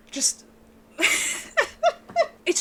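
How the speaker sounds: laughing. Real, not acted. Overwhelmed by a sad ending.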